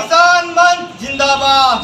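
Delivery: chanted rally slogan; protest slogan call. Real, not acted. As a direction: lead the crowd's chant. Leader calls out the slogan.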